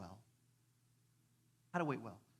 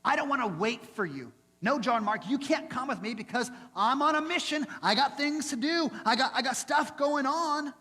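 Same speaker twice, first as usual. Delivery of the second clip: impatient Paul; 7 semitones higher, 5 dB louder than usual. Retold or imitated. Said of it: "Paul was the guy who was like".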